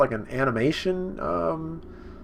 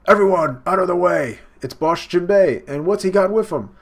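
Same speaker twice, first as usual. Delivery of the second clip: higher, louder announcing shout; calling out; 6 semitones higher, 7 dB louder than usual. Retold or imitated. Townsfolk announcing Boss Jinbei, a loud higher shout. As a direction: clear the street with a shout.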